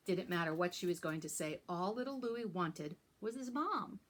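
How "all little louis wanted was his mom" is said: plain reading voice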